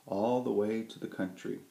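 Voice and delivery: ordinary delivery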